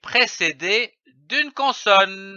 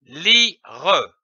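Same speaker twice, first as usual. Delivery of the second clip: "lire" split in two with a sounded final "re"; "lire" in detached reading-lesson syllables. Shown as a demonstration. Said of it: "L'association de ces syllabes donne".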